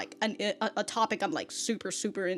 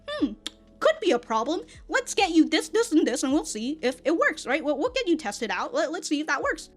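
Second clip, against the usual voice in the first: lightly voiced helpful doctor, raised pitch; accommodating doctor; 7 semitones higher, 4 dB louder than usual. Main doctor reassuring the patient, lightly higher voice. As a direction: play the agreeable main doctor. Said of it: "he'll just be like".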